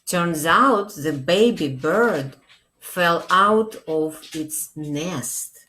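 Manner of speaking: ordinary delivery, nothing marked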